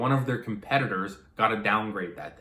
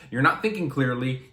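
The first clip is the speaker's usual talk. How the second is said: ordinary talk, nothing marked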